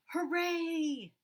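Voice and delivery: high, loud happy cheer; excited cheer. Acted. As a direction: cheer like thrilled little chicks. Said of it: "they cried".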